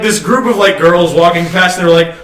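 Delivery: ordinary talk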